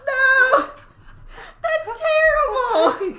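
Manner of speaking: horrified laugh at a grim story; laughing disbelief, high and loud; laughing shout. Real, not acted. Shocked by broken neck story.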